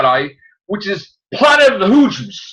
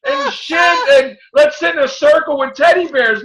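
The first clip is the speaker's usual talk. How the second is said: put-on outraged kid shout; 9 semitones higher, slower than usual. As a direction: yell it like a furious kid at the movie. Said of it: "I'm like".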